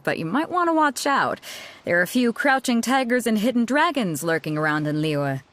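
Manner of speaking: ordinary talk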